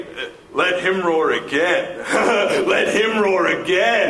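imitating the Duke. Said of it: "make the Duke say".

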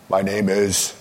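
bold superhero introduction; heroic superhero. Shown as a demonstration. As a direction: announce yourself like a hero. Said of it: "Practice your superhero voice".

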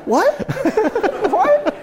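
high, loud comic disbelief; astonished yelp, laughing between the words; retold astonishment. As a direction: squawk it in mock bewilderment. Retold astonishment at celebrity hug.

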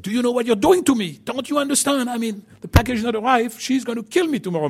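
high, pleading panicked husband; a husband who forgot the anniversary, pleading on the phone; upset customer. Retold or imitated.

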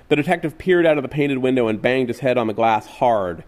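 usual speaking voice

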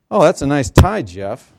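loud, high mock-sweet jab; sarcastic compliment. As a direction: fake praise with a sarcastic bite. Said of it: "the great thing about sarcasm is".

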